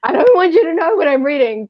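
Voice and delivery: high, flustered protest; embarrassed. Retold or imitated. Retelling being caught reading by parents, high and embarrassed. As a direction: blurt it out, mock embarrassed. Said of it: "I felt so embarrassed".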